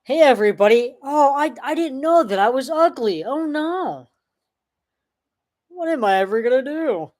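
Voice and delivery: high, hammed-up fake despair; mock distress. Streamer mocking chat by faking distress. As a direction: ham up being upset to mock them. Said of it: "I'm just playing".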